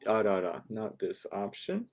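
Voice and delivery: ordinary delivery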